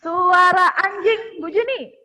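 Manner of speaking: loud, high, slow announcement; calling out. Real, not acted. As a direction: call it out like a game host. Calling out animal sound turns.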